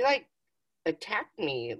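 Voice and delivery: normal talking voice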